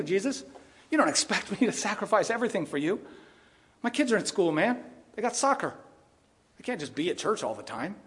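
a hint of an evasive friend, kept low and soft; Peter making excuses. Pastor voicing Peter dodging Jesus' call, softly and evasively.

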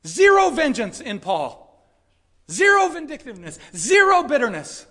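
loud, high preaching at full force; fervent pulpit shout; impassioned yelling. Real, not acted. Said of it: "should I be yelling like that".

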